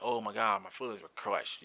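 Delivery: low, soft, unimpressed mock upset; mock hurt. Parent who doesn't hurt easily, mock hurt. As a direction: feign being wounded, barely bothering. Mocks the idea of being hurt.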